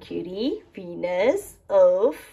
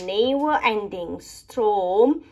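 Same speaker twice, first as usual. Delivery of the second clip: ordinary delivery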